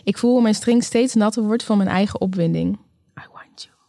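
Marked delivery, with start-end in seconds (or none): 3.2-3.8 very soft, voiceless whisper. A narrator's reading. Woman telling her lover she wants him, whispered. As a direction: whisper it intimately, barely audible. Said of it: "fluister ik".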